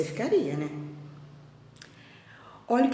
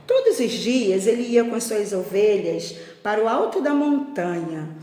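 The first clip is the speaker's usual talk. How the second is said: expressive storyteller with drawn-out key words; lilting picture-book reading; children's story narration; 8 dB louder than usual. A narrator's reading. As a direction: read it aloud to a class of little ones. Reads story to pupils.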